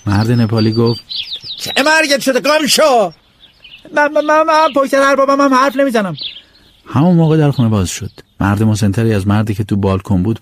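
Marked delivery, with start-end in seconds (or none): 1.6-3.1 much higher, snappy brush-off; annoyed servant. Acted. Servant chases him off. 3.9-6.1 much higher, tripping over words; boy insisting he never gossips; stammering plea. Acted.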